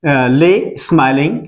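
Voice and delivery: very slow, each word spelled out for students; slow teacher dictation; slow model answer. Shown as a demonstration. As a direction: say it slowly so the class can copy. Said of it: "teacher models English answer".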